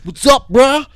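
high, loud Californian surfer-bro voice; mock Californian bro. Driver cut off in traffic, mock Californian bro. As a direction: pitch it way up, a cocky Californian bro. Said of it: "is that how we fe-, look at fucking Californians?".

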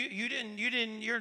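ordinary talk, nothing marked

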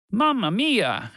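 shouting. A narrator's reading. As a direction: cry out in alarm, stretching the words. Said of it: "shouted a larger man".

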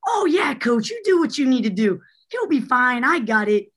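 high, gushing fan imitation; eager reassurance. Nanny who is a big fan reassuring the coach, eager and high. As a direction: pitch up, eagerly waving the worry away.

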